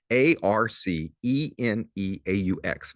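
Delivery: each letter slow and distinct; A R C E N E A U X spelled one letter at a time, slowly. Shown as a demonstration. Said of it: "get 'em all correct".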